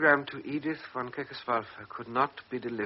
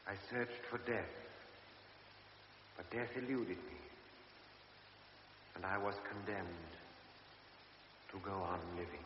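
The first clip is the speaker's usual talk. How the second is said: much softer, fading away to almost nothing; fading confession; 4 semitones lower, 16 dB quieter than usual. Acted. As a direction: let the last words die away in despair. Closing confession fading to silence.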